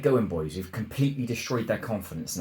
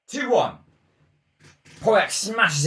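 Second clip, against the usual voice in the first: elated; 9 semitones higher, 4 dB louder, slower than usual. Real, not acted. Just scored a goal.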